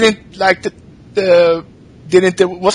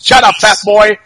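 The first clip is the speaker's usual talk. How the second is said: louder barking taunt; mock heel taunt; 4 semitones higher, 5 dB louder than usual. Flair as a heel taunting an opponent, loud. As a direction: sneer it like an old-school heel.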